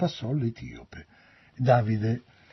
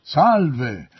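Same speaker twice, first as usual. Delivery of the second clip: loud, raised hail; cried greeting; 4 semitones higher, 9 dB louder than usual. A narrator's reading. Ahimaaz greets the king as he arrives, shouting. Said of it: "gridò".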